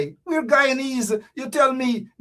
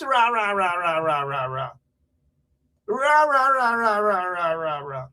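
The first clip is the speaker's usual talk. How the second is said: rah-rah babble sliding down in pitch; raucous ranting noise; 3 semitones lower than usual. Acted. Imitating politicians' ranting, descending babble. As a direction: run it out like a winding-down tirade. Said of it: "All them chaps".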